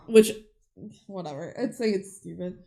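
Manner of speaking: normal talking voice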